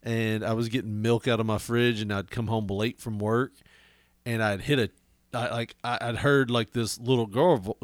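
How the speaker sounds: usual speaking voice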